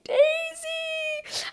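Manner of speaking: very high, drawn-out squeaky wail of a name; mock wail. Viewer aching for the rejected contestant, high mock wail. Viewer's reaction to heartbreaking scene.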